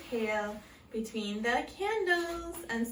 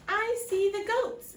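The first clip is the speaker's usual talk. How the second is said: high, louder rabbit call; little rabbit spotting the goats; 10 semitones higher, 5 dB louder than usual. A narrator's reading. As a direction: pipe up like an eager bunny. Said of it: "Rabbit said".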